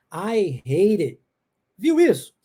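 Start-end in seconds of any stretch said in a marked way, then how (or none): 0.3-1.2 "I hate it" low, with the voice descending on the end; falling ending. Shown as a demonstration. Teacher pointing out the final descent, low falling "I hate it". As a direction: let "I hate it" slide down to a low finish. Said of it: "uma descida no final".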